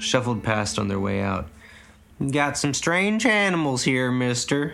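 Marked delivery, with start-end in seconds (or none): 2.3-4.7 put-on higher, slow old farmer voice; lightly voiced old Mississippi farmer. A narrator's reading. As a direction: drawl it out like an old country man. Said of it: "said the old man".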